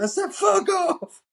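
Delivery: very high, loud yell; hammed-up outburst at the top of the voice; mock furious shout. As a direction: imitate a teen screaming it back. Acted quarrel, John shouts back.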